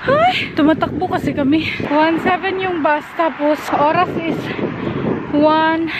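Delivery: panting hard after running for the bus; gasping between words, winded; out of breath. Real, not acted. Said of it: "Hinihingal kami".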